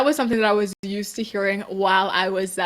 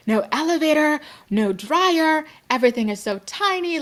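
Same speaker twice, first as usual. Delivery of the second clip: higher-pitched acted complaint; mock dismay; 7 semitones higher than usual. Recalling her first reaction to small Paris homes, mock dismay. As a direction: list each shortcoming in playful disbelief.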